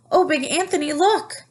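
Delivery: high, loud startled call; alarmed cry. A narrator's reading. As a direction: point at the disaster in alarm. Nun spots overflowing pot.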